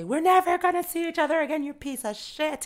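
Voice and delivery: shouted high then slowing on the insult; hammed-up breakup yell, dragging the last words; mock angry outburst. Acted fight quote to friend.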